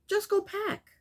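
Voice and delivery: high-pitched, impatient dismissal; exasperated. Real, not acted. Vlogger fed up with him announcing he'll pack, high exasperation. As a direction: voice jumps up in exasperation.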